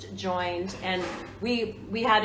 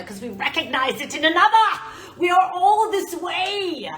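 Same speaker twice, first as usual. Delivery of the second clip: dramatic proclamation; 11 semitones higher, 10 dB louder than usual. Pretend. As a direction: proclaim it like a theatre finale. Dramatic declaration about fans.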